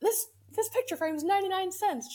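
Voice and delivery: high-pitched, thrilled bargain announcement; excited retelling. Retold or imitated. Retold excited call to mom.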